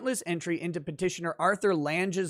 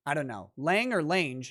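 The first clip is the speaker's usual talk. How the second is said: ordinary talk, nothing marked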